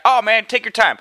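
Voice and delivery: high, easygoing, lightly Southern voice; good old Southern boy. Retold or imitated. Salesman to customer taking another call, light Southern accent. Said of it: "this good old Southern boy".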